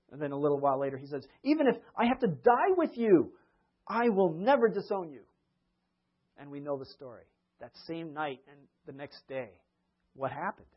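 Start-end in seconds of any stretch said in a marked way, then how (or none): 1.4-5.2 higher, louder insistent pledge; bold vow. Retold or imitated. Peter vowing to die with Jesus, insistent. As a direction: swear it earnestly.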